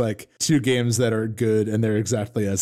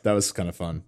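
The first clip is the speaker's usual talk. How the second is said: usual speaking voice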